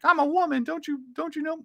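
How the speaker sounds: high falsetto caricature of a woman; mock woman's voice. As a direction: put on a very high, mocking feminine voice. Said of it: "men are coming out being like".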